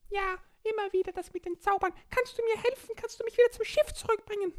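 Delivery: high squeaky little creature voice; small child voice. Acted. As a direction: speak like a tiny excited child. Borki pleads.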